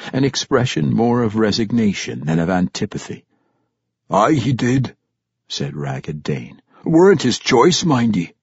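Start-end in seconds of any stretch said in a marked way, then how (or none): 4.1-5.0 put-on gruff old dwarf warrior. Old warrior Ragged Dane voiced. 6.8-8.3 loud, high gruff dwarf scoff; gruff old dwarf warrior. Acted. Old warrior Ragged Dane voiced.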